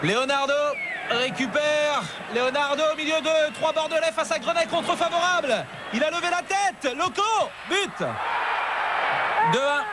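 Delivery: high goal call; excited sports commentary. Real, not acted. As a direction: climb to the goal. Counterattack, Loco scores.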